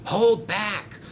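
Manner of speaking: high, loud chant; calling out. Acted. Engines secretly resist Sam, calling out loudly. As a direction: call it out high to the trucks.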